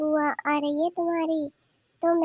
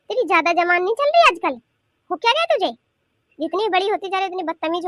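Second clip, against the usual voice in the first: loud, rising rebuke; raised-voice telling-off; angry scolding; 3 semitones higher, 6 dB louder than usual. Acted. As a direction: scold a cheeky granddaughter, pitch shooting up. Grandmother scolds a cheeky granddaughter.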